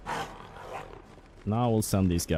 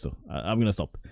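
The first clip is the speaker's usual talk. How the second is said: normal talking voice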